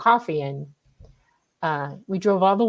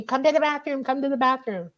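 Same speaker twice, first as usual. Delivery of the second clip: mock urgent summons, pitched way up; retold urgent calling; 7 semitones higher than usual. Friend at school urgently pulling her aside, high mock call. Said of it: "She's screaming at me".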